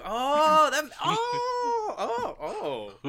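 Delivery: loud, laughing, high-pitched oh-oh; shocked laughing realization. Retold or imitated. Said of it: "I was like".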